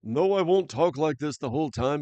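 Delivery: put-on loud joking line still in the made-up Russian-ish accent; mock Russian-ish accent, half dropping it. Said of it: "I just made up this accent".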